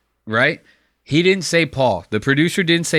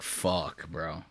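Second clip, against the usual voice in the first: low muttered curse, much softer; 10 semitones lower, 14 dB quieter than usual. Retold or imitated. Voicing Joel's inner panic, a low soft muttered curse. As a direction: mutter it under your breath in dismay. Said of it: "Joel's like".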